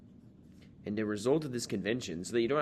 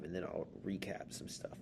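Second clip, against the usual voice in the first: whispered aside; 9 dB quieter than usual. Real, not acted. Aside to students.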